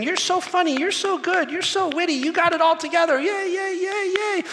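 high, sing-song praise; mock adoring crowd. Preacher mocking the crowd's earlier praise of Jesus, high and gushing. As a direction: gush like fans.

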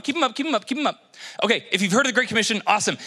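ordinary talk

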